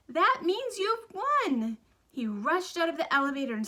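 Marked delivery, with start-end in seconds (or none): 0.1-1.8 high, slow, thrilled cry; exploding with excitement. A narrator's reading. Mister Wonka telling Charlie he won, thrilled and slow. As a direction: burst out with delight at the news. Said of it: "exploded with excitement".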